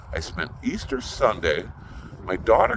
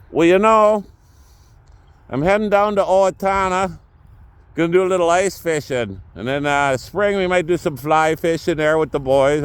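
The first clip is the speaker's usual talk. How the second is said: high, louder Minnesota accent impression of Gary; 6 semitones higher, 4 dB louder than usual. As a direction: play Gary as a cheery Minnesota fisherman, pitched up. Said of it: "we do talk like this".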